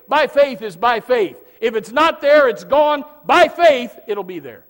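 rising to a near shout, hammering by faith; preaching at full force. Real, not acted. Preacher shouting to the church that faith means no savings. Preacher drives home his point.

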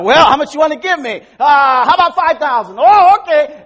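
high, loud mock haggling; haggling buyer and seller. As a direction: bargain loudly, hammed-up. Mock haggling over price.

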